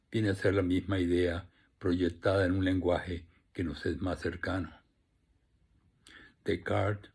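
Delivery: measured, phrased reading with deliberate pauses; formal literary address; formal essay reading. Acted. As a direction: read a prepared literary note aloud to an audience, each phrase starting firm then falling away. Reads prepared note on writing.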